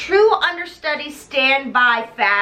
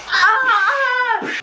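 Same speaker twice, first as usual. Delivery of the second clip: high-pitched comic scream; mock scream; 10 semitones higher, 3 dB louder than usual. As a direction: scream as the set falls. Acts out the set falling down.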